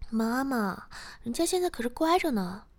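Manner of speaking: high, loud pouting daughter; coy protest. Acted. Daughter insisting to mother she behaves, coy protest. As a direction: playfully whine in protest, voice climbing.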